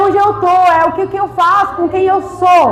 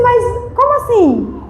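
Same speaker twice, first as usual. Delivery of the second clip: higher-pitched, drawn-out puzzled question; retold bafflement; 7 semitones higher, much slower than usual. Speaker recalls answering the magazine's request, baffled. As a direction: act out your own puzzled reply.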